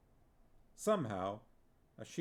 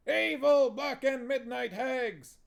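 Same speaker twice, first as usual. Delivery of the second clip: high, loud, slow hag chant; witches yelling; 13 semitones higher, 8 dB louder than usual. Acted. Witches getting into the spirit, loud high slow yell. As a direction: yell it like gleeful hags.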